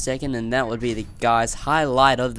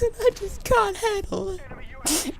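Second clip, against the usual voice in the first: mock breakdown; slower than usual. Mock breakdown ending rant.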